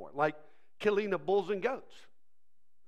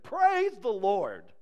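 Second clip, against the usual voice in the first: slow, loud proclamation; emphatic psalm reading; exclaimed scripture; 5 dB louder, much slower than usual. Acted. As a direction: proclaim it with drawn-out weight.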